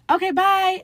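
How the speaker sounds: high, loud sing-out goodbye; hasty escape. Retold or imitated. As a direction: call it out high and bolt. Acting out fleeing after coming out.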